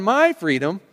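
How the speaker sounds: "my" punched high and loud; stress on MY. Shown as a demonstration. Preacher pointing out the emphasis, strong stress on "my". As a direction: hit "my" hard, then finish "freedom". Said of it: "the emphasis on".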